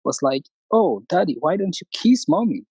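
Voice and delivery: ordinary talk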